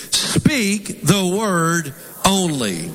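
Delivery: very slow, measured word by word; slow emphasis. Shown as a demonstration. Preacher has congregation say it again, slow emphasis. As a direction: stretch each word for repeating along. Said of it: "I really want to emphasize this".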